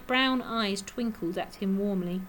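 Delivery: usual speaking voice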